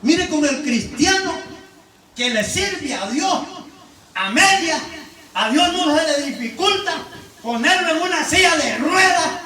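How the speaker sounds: loud, high, rousing sermon voice; full-force preacher calling the flock to God; fiery preaching. Acted. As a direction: preach it out to the whole church.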